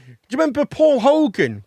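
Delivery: ordinary talk, nothing marked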